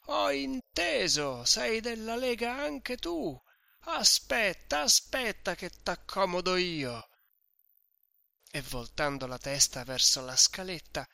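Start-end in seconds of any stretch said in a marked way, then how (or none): 0.1-7.1 put-on much higher, slow drunken threat; louder. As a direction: rant on at the host, louder. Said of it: "continuava più forte". (minaccia ubriaca, molto più acuta)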